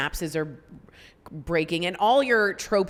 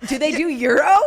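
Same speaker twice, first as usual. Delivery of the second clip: ordinary delivery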